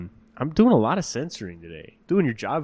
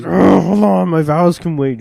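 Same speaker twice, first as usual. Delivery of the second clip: raised, loud, hammed-up put-off; mock impatient stalling; 7 semitones higher, 8 dB louder than usual. Groom putting off vows for a game, mock impatient. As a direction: fend them off loudly, absorbed in a game. Acting groom too busy gaming.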